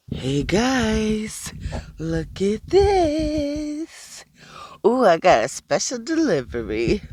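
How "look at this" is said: very slow, higher and stretched; a slow, lilting presentation; drawn out show-off. Acted. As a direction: drag it out, presenting the mess. Showing hair, drawn out.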